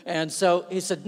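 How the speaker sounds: usual speaking voice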